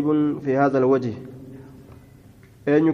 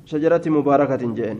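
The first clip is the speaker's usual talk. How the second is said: usual speaking voice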